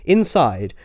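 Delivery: plain reading voice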